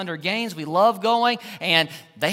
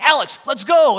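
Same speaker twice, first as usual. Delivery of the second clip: a quick, eager call to the son, louder and higher; excited call; 6 semitones higher, 9 dB louder than usual. Retold or imitated. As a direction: hurry him along with excitement. Dad calling son to run.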